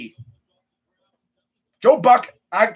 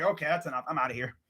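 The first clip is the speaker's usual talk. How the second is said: low, soft offhand aside; done with it; 6 semitones lower, 11 dB quieter, faster than usual. Acted. Fan imagining Joe Buck leaving the booth, done with it. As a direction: drop the act and sign off quietly. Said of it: "imagine him in the freaking booth".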